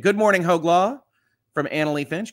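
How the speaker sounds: ordinary delivery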